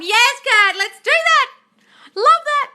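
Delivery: squealing high and loud with delight; excited. Real, not acted. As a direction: gush with excitement to Kat. Excited reply to a viewer.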